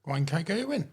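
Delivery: "Gwaun Caergyrwin" with careful Welsh sounds; Welsh pronunciation. Real, not acted. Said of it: "He even pronounced it well".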